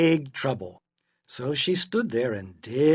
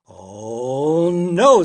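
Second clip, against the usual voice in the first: loud, drawn-out groaning protest; exasperated parent's big objection; dismayed refusal; 2 semitones higher, 11 dB louder than usual. A narrator's reading. Father refuses the junk food.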